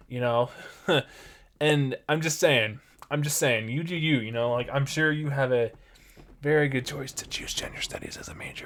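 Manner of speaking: normal talking voice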